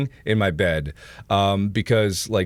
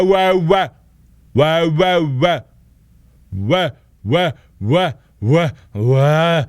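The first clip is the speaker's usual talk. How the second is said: high, loud, repeated wahs; loud wah-wah noises; 6 semitones higher, 7 dB louder, much slower than usual. Real, not acted. Streamer testing laggy audio for viewers, loud wah-wahs. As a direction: blare out wah after wah.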